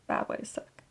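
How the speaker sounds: breathy whispered punchline; whispered aside. Acted. Whispering to viewers about bad sickly pink. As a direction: drop to a whisper for the joke. Joking aside on sick look.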